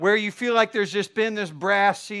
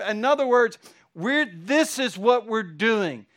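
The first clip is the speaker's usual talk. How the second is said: higher and louder, building to a peak; full-force preaching; 5 semitones higher, 5 dB louder than usual. Acted. Pastor to congregation, climbing to a shouted peak. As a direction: pour it out like the sermon's climax.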